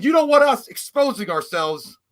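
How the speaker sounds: high, loud, slow delivery; drawn-out mock announcement; retold loud protest. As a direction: call it out to a courtroom in jest. Retold joke line to the court.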